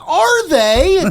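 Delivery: high, loud mocking repeat of Are they; mocking echo. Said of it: "And I went".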